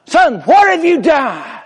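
retold angry scream. Preacher voicing the auditor father screaming at his son. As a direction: blast it out like a startled, angry dad. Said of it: "he screamed at the son".

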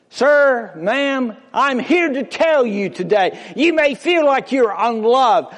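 high, loud preaching call; rising preaching. Real, not acted. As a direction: lift the voice and call out to the congregation. Preacher raising his voice.

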